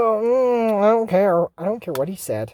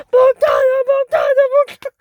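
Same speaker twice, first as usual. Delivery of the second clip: high, loud repeated battle cry; frantic cartoon attack call; shouted spell; 6 dB louder than usual. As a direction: fire off each word as a high yell.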